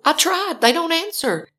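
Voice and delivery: high, frustrated protest; exasperated. Retold or imitated. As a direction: protest that you're out of options. Exasperated answer to dispatcher.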